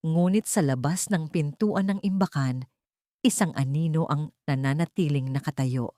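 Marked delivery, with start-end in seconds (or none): none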